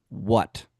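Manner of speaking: low, gruff annoyed "What?" from a man just woken up; grumpy man. Retold or imitated. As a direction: bark "What?" low and irritated, like a guy woken mid-sleep. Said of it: "clearly upset".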